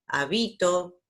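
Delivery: habito said as ha-BI-to; penultimate stress. Shown as a demonstration. Teacher giving examples of graves, middle stress on habito. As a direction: lean on "bi" in habito. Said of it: "suenan fuerte en la anteúltima".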